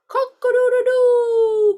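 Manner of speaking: loud, high, drawn-out crowing; rooster's crow. A narrator's reading. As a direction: crow like a rooster, holding the last note. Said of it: "crowed the rooster".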